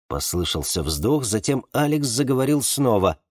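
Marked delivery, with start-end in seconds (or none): none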